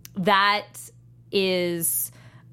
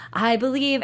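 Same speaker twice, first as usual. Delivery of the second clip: higher, louder mock-earnest quote; so serious; 7 semitones higher, 4 dB louder than usual. Imitating the character's so-serious entrance line, mock-earnest. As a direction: imitate his deadly serious declaration. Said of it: "he's so serious".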